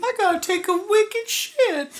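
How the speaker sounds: put-on very high, squeaky baby talk; mock baby voice. Co-host joining the baby role-play, high mock baby voice.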